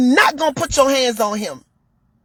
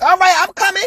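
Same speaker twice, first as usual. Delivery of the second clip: higher-pitched, put-upon mock waitress; rude waitress; 4 semitones higher, 3 dB louder, faster than usual. Waitress to a man trying to order, rude. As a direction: imitate a waitress brushing off a customer. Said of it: "The waitress was very rude".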